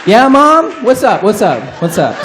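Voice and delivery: chirpy yes-mom reply dropping down bit by bit; hammed-up obedient kid answering mom; eager kid answering. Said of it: "And I'm like".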